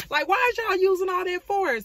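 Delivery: high, loud whine of complaint; indignant acted squeal; mock outraged protest. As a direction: protest it at the top of the voice. Acted protest at painful cleaning.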